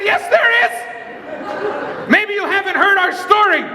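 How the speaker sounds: high-pitched insistent retort; heated reply. Retold or imitated. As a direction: fire back, pitch way up. Arguing back loudly.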